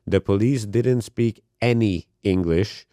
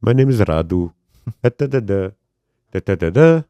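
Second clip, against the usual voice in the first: awkward Romanian comic on stage, halting bursts; punchy, awkward Romanian deadpan impression; Radu; 5 dB louder than usual. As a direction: imitate a stiff comedian, long gaps between phrases. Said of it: "very good impression of Radu".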